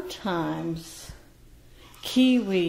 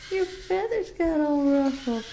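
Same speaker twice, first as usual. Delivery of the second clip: higher cooing repeat to the bird; high pet talk; 8 semitones higher than usual. Real, not acted. Owner teasing Beauty about ruffled feathers, high pet talk.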